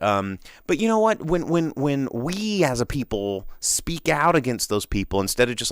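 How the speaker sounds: ordinary talk, nothing marked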